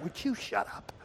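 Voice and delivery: soft, sharp shushing; hushed rebuke. Retold or imitated. Soldiers telling young David to be quiet. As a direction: hiss it to quiet him down.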